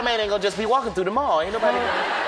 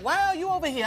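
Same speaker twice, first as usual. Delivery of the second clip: Jackie Chan impression; 9 semitones higher than usual. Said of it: "he was like".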